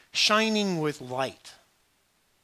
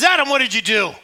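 loud, higher demand; God confronting; 8 semitones higher, 12 dB louder than usual. Acted. God demanding of Adam what he did, loud. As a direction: call Adam to account.

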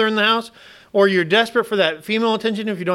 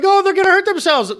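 much higher, louder alarmed mother voice; mock worried wife; 13 semitones higher, 4 dB louder than usual. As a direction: squeal with mock panic about the kids. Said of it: "My wife's like".

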